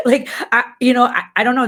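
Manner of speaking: amused disbelief, laughing as she talks. Real, not acted. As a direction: giggle through the words. Laughing at surprise gift.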